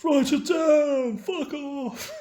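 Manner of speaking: high, drawn-out mock shout; over-the-top angry celebrity yell, stretching the words; Gordon Ramsay. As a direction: holler it slowly in a high put-on voice. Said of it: "Look at Gordon Ramsay".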